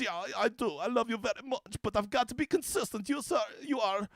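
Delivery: soft put-on German accent. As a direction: gently confess your love in mock German accent. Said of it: "he actually taught me the German accent".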